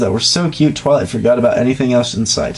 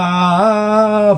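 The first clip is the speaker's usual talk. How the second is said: higher hammed-up cry of ah; mock scream; 8 semitones higher than usual. Reading Scootaloo's climax scream, hammed-up high ah. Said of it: "finished with a scream of".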